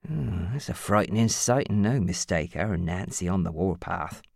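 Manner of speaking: lightly voiced old man; wry grandad. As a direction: grumble fondly about the women. Don voiced.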